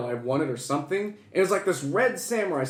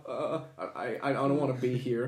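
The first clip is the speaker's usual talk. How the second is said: softly imitated tearful nerves; nervous, tearful; 5 semitones lower, 9 dB quieter than usual. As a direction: stammer it quietly, like holding back tears. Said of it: "getting nervous, and I, like, tears were coming out".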